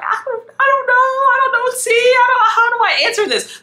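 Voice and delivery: very high, panicky stammer; flustered. Retold or imitated. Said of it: "Brandon is flustered".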